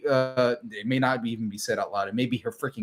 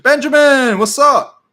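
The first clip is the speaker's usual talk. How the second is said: much higher, louder cheerful hello; excited greeting; 12 semitones higher, 11 dB louder, slower than usual. Real, not acted. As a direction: call out happily to a viewer. Greeting a chat viewer.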